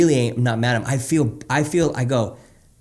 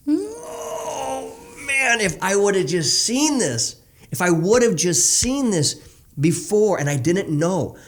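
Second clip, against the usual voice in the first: choked-up regret; 7 semitones higher, much slower than usual. Real, not acted. Regret over his past self.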